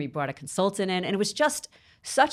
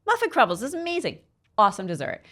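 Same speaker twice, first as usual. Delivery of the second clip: much higher, gushing; delighted; 7 semitones higher, 4 dB louder, faster than usual. Retold or imitated. Mom cheering up her daughter, gushing and high. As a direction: sell the disaster as a treat. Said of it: "I was like".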